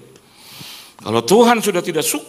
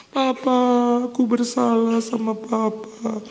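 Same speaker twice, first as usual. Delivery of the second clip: high, slow, drawn-out mock plea; pleading child; 10 semitones higher, much slower than usual. Child begging papa for forgiveness, mock pleading. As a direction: beg like a wailing child, hammed up.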